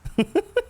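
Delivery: high shrieking laugh burst; wonderful cry. Real, not acted. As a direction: let out a squealing high laugh. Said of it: "That's my wonderful cry".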